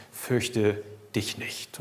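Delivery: very soft, slow and low soothing; tender comfort. Acted. Father holding the scared child, soft and slow. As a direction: hush a frightened child in your arms.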